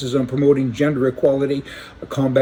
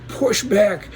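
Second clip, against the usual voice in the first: emphatic push back; 7 semitones higher than usual. Real, not acted. Rallying viewers to resist, pitched up. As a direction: back pitched much higher.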